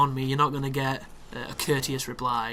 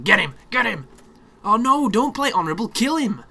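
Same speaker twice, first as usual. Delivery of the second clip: high, louder egging on; eager urging; 7 semitones higher, 4 dB louder than usual. Real, not acted. Gamer urging one player to kill the other, high and eager. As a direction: get them to finish it.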